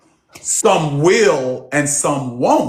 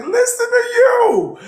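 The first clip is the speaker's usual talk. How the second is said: much higher, slow delighted exclaim; amazed; 4 dB louder than usual. Retold or imitated. Coach marveling at his client's new confidence, delighted. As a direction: marvel at her change. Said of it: "I was like".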